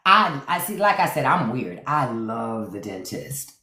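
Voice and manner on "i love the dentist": low, slow, softer admission; deadpan confession. Real, not acted. Admitting to viewers she loves the dentist, low and slow.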